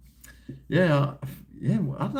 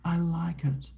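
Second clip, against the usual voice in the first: lightly voiced wife, higher and slower; wife's reply; 7 semitones higher, 3 dB quieter than usual. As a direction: mimic the wife calmly refusing, a touch higher. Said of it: "She goes".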